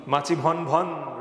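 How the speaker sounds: মাছি ভন ভন recited slowly with a sing-song buzz; teacher reciting the buzzing rhyme; slow rhyme recital. Shown as a demonstration. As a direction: chant it like a children's rhyme. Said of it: "একটা কবিতা পড়েছিলাম".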